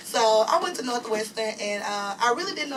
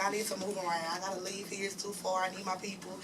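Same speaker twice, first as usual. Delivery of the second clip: quiet plea; 3 semitones lower, 9 dB quieter than usual. Retold or imitated.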